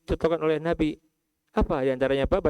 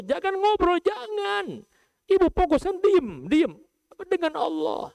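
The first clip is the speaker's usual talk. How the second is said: high scolding hush; a hint of a pilgrim hushing others; mock scolding; 14 semitones higher than usual. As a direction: shush the chatterers, pitched high. Mimics scolding pilgrims chatting.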